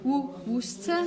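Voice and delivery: "Worcester" said as "WUS-tuh"; U-sound demo. Shown as a demonstration. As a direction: model "Worcester" with an U vowel, two syllables. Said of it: "This is an U sound".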